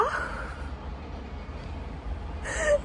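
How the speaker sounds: high, whispered giggle; breathless giggle. Real, not acted. Giggling at her craving.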